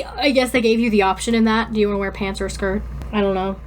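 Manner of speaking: ordinary talk, nothing marked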